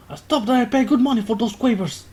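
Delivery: hammed-up bellow over crisps; bellowing shopkeeper. The shopkeeper bellows at the crisp-throwers, outraged. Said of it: "The man bellows".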